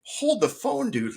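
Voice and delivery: very high, incredulous objection; high-pitched offended protest; indignant pushback. Retold or imitated. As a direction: cut him off in disbelief, pitch shooting up. Indignant reply to the doctor.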